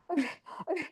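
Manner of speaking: acted gasping, too winded to speak; faint panting stammer; out of breath. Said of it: "I'm out of breath".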